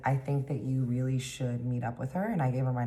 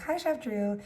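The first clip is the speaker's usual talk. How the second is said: high greeting; 11 semitones higher than usual. Retold or imitated. Greeting the chef in a story.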